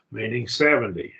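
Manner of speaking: ordinary talk, nothing marked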